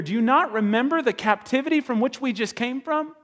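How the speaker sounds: high, loud rebuke of a stern leader; Nehemiah scolding. Acted. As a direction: scold the people for forgetting. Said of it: "he says to these people here".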